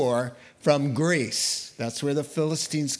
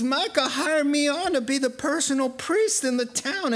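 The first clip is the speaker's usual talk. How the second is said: smug hired priest; 10 semitones higher than usual. Retold or imitated. Priest voiced in retold story.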